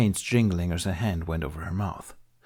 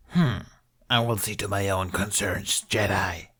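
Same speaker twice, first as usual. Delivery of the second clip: Hutt voice. Acted. Hutt rebuffs Ben.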